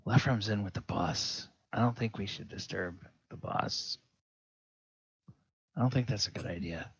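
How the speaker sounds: hushed and fast, afraid to disturb the boss; nervous hush. Acted. Geralf nervous about the boss.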